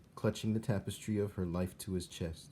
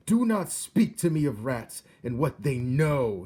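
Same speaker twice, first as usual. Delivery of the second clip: loud high royal shout; 6 semitones higher, 9 dB louder than usual. A narrator's reading. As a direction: shout with hands over your ears. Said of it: "he shouted".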